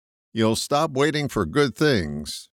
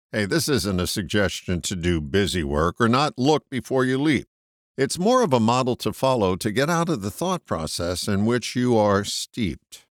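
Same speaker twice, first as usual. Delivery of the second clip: ordinary delivery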